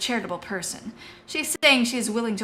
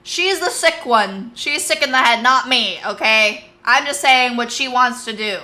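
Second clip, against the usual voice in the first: louder, higher defensive outburst; loud protest; 4 semitones higher, 10 dB louder than usual. Real, not acted. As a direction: insist it's not you. Defending herself to chat, loudly.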